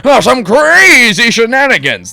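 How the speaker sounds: high, loud, slow pompous voice; pompous principal. Acted. Principal greets the boy in his pompous voice. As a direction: purr like a self-satisfied old gent. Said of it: "This voice requires this arm position".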